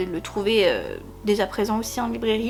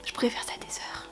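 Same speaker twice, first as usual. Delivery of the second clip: hushed, whispered aside; barely audible whisper; 13 dB quieter, faster than usual. Real, not acted. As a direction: whisper it very softly, as if to yourself. Whispered aside over book's raised cover.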